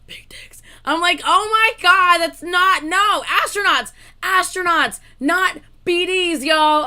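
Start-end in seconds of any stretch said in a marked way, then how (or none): 0.1-0.6 whispered quote. Retold or imitated. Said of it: "they literally like". 1.2-6.8 loud laughing protest; half-shouted protest, slowing on "not B Ds"; real loud protest through laughter; yelling. Real, not acted. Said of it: "Oh, I am yelling".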